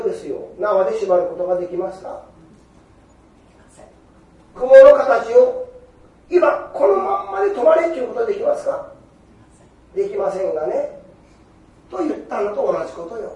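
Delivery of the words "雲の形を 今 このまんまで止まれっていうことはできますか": emphatic question. Acted. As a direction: hammer the question home to the hall. Preacher presses the congregation.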